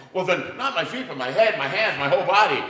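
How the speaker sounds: overeager, throw in your whole body; eager Peter. Acted.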